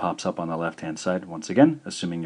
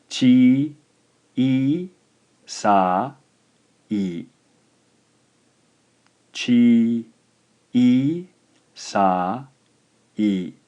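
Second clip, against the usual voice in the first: "chi, i, sa, i" spaced out, long vowels held apart, louder; syllable by syllable; 4 semitones higher, 6 dB louder, much slower than usual. Shown as a demonstration. Teacher dictates a word with long vowels: slow syllables. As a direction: dictate each syllable slowly. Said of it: "contains long vowel sounds".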